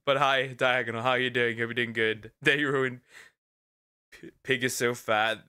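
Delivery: normal talking voice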